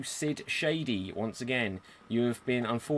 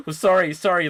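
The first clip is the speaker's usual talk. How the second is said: flustered apology; 5 semitones higher, 8 dB louder than usual. Real, not acted. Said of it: "GM apologises after mistake".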